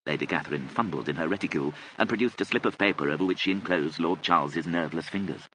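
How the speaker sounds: ordinary delivery, nothing marked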